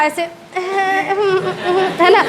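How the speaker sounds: slow, sheepish little laugh; shy giggle after a wrong answer; embarrassed titter. Shown as a demonstration. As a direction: laugh at yourself, shyly. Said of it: "उसको हम बोलते हैं Teeter".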